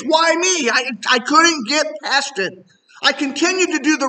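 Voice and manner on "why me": high, louder why me; exasperated cry. Retold or imitated. Preacher retells his bitter prayer, high exasperated cry. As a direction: throw it up at heaven, exasperated.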